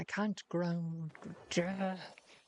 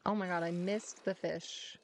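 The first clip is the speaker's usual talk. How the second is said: usual speaking voice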